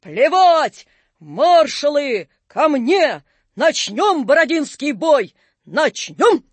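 loud, high, slow battle cries; rallying shout. A narrator's reading. Napoleon rallies marshals for Borodino, loud defiant shouting. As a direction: fire off each command as a big cry.

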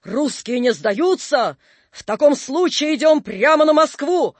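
high, ringing declamation; shouted proclamation. A narrator's reading. Napoleon orders march on Moscow, high rallying shout. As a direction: proclaim it boldly to the marshals. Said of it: "крикнул Наполеон".

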